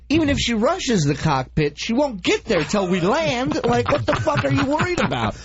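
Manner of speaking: incredulous. Retold or imitated. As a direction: sneer at the pointless search. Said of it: "I'm going".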